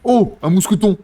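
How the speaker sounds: high, loud cry of delight; excited shout. Real, not acted. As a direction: yell it out, thrilled. Rare item found in game.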